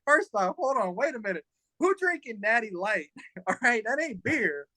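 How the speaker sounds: high, mocking disbelief; laughing disbelief at a Natty Light; incredulous. Incredulous retold reaction to Natty Light.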